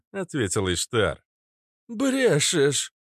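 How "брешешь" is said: high, punchy cry of disbelief; disbelieving exclamation. A narrator's reading. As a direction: blurt it out, pitched high. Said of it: "одновременно воскликнули принц и фитилёк". (недоверчиво воскликнуть)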